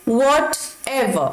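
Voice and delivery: "whatever" drawn out very slowly, r silent; slow model pronunciation. Shown as a demonstration. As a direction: stretch "whatever" and drop the r. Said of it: "इसे pronounce करेंगे whatever". ("whatever" बहुत धीरे, बिना आर के)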